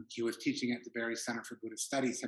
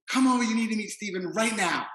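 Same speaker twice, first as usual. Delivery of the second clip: put-on French-Korean accent; 10 semitones higher, 10 dB louder than usual. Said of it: "almost Korean accent".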